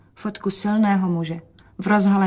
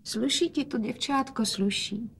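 soft, higher, tender praise; quietly; 3 semitones higher, 6 dB quieter than usual. A narrator's reading. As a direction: murmur it gently to your daughter. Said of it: "hovořil otec tiše". (tiše)

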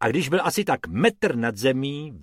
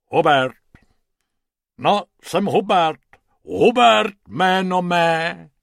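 lightly voiced booming hippo; 6 semitones higher, 6 dB louder than usual. Hippo introducing himself out of the pool, hippo voice. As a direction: introduce yourself as a hefty hippo. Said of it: "řeklo hroším hlasem".

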